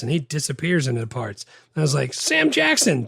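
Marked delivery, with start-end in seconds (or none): 2.1-3.1 a sudden high, loud burst of delight at the name; excited exclamation. Retold or imitated. Rereading his own notes, he exclaims over a cast member. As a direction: blurt it out like spotting a favourite actor in the cast. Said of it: "three exclamation points".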